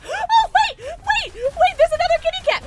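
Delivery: very high, loud exclamation; real delight; excited. Real, not acted. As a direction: squeal in surprise. Excited spotting another.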